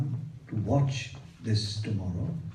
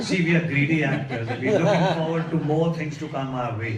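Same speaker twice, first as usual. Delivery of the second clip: chuckling through the words, a touch louder; laughing; 5 semitones higher, 6 dB louder than usual. Real, not acted. Actor laughing to the interviewer about wanting more roles, laughing. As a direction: laugh it off while admitting you're greedy.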